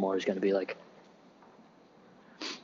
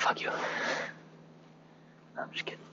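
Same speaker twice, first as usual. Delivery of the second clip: mock whisper; 10 dB quieter, much slower than usual. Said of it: "I'm like".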